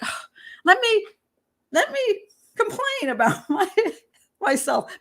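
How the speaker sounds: mock whine to be left alone; high pleading whine, half laughing; whiny complaint. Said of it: "And I'm like".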